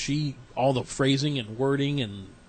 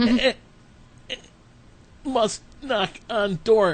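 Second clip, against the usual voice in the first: higher, slow mock caveman stammer; frozen at the door, stammering; nervous stammer; 7 semitones higher than usual. Too nervous to knock on the interview door, hammed-up stammer. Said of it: "I'm like".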